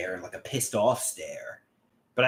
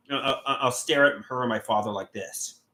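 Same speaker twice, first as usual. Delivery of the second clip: normal talking voice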